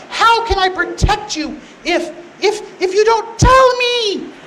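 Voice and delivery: slow high scolding, building to a loud wail; mock exasperation. Delilah demanding Samson's weakness, hammed-up exasperation. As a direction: nag with each word leaned on, rising to a yell.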